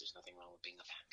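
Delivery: soft, fast whispered aside. Real, not acted. Confiding aside to podcast listeners, fast whisper. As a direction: lean in and whisper it.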